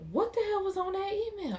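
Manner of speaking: shoots way up high, stunned and loud; shocked outburst. Retold or imitated. Viewer reacting to the husband's email, shocked outburst. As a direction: blurt it out in disbelief. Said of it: "I'm like".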